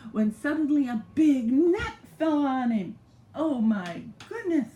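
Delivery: put-on spring the twist on the child; gasping story surprise; sudden surprise. Net falls on lion.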